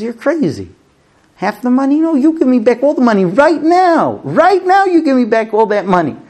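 high, insistent, outraged bargaining refusal; storyteller's heated, high-pitched tirade; acted angry demand. Acted furious demand to the bandits.

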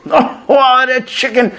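high, gushing praise of the chicken; mock delight. Rabbi voicing a diner raving over chicken, high and gushing. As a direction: rave about the food, hammed up.

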